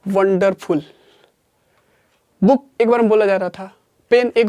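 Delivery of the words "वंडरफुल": ordinary talk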